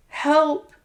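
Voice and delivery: high, breathless cry; desperate cry for help. Acted. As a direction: call out weakly in panic. Beaten woman calls for help.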